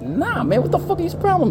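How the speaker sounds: high-pitched mock outrage; acted-out indignant protest; indignant refusal. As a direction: refuse in exaggerated, high disbelief. Refusing an unwanted proposition, retold.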